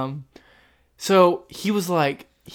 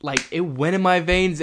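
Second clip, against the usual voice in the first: ordinary delivery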